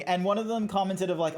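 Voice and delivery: ordinary talk, nothing marked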